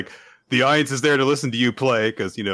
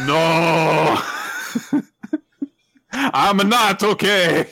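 drawn-out theatrical "no"; hammed-up anguished wail; mock breakdown; 6 semitones higher, much slower than usual. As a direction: wail it like a melodrama. Acted breakdown in sheet music.

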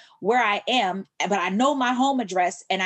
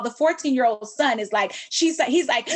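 ordinary delivery

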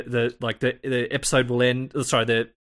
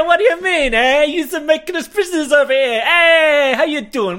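put-on loud, high-pitched, over-the-top Brooklyn accent; Brooklyn guy; 13 semitones higher, 6 dB louder than usual. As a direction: mouth off like a cartoon Brooklyn loudmouth. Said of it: "like, a Brooklyn guy".

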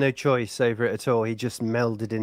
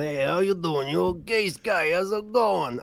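put-on aping an American accent; put-on American greeting, mock casual; 5 semitones higher, slower than usual. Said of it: "I never start aping American accents".

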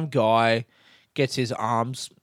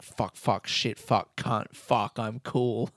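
muttered swearing; 4 semitones lower, 6 dB quieter, much slower than usual. Retold or imitated. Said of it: "he's just like".

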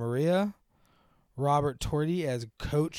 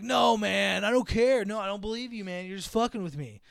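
1 disbelieving bro; 6 semitones higher than usual. Retold or imitated. Disbelieving guy voiced.

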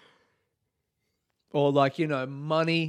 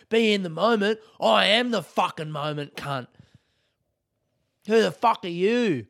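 mock hype; 7 semitones higher, 6 dB louder than usual. Hyping himself up.

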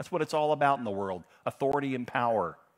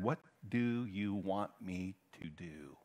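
soft, lower, word by word; gentle slow question; 4 semitones lower, 8 dB quieter, slower than usual. Acted. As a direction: ask it slowly and softly, as Jesus. Jesus's question, read slowly.

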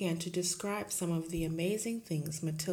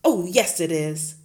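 loud shout; 14 dB louder than usual. Acted. Headmistress shouting down the teacher, loud. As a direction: shout her down. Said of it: "shouted Miss Trunchbull".

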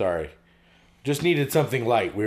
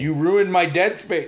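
loud, high-pitched playful complaint; mock accusation; 7 semitones higher, 6 dB louder, slower than usual. Host blaming co-host for spoiling silence, mock-aggrieved. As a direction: accuse them in mock outrage. Mock quarrel over dead air.

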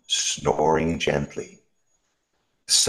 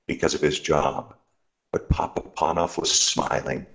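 ordinary delivery, nothing marked

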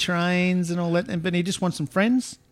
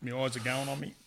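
lips burning from chilli sauce; mouth on fire; 4 semitones lower than usual. Real, not acted. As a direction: speak through a burning mouth. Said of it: "My mouth is on fire".

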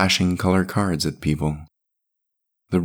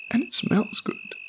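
put-on very high, soft child's voice; tiny voice, mildly faltering; stammering boy; 5 dB quieter than usual. A narrator's reading. Boy tells woman of the bear, high, soft, faltering. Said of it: "he stammered".

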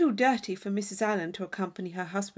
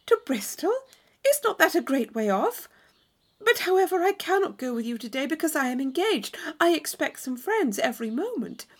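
put-on higher-pitched young heroine, protesting; surprised Catherine; 5 semitones higher than usual. Declining a sudden outing because she is engaged, surprised and protesting. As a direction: raise the voice in surprised, polite objection. Catherine answers the invitation in a story.

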